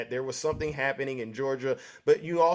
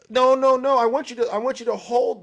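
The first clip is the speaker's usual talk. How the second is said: loud interrupting; 7 semitones higher, 7 dB louder than usual. Real, not acted. Host cuts off caller loudly.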